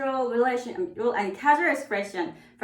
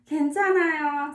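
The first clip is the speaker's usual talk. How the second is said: quick repeat; 5 semitones higher, much faster than usual. Shown as a demonstration. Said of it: "First one is".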